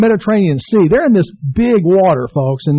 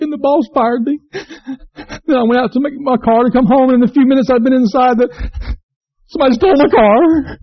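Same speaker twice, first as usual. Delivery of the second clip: higher, whiny hammed-up crying; mock sobbing; 7 semitones higher than usual. Sad drinker tells the troublemaker his day, in mock sobs. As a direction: whimper through the whole sad list. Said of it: "the guy starts sobbing".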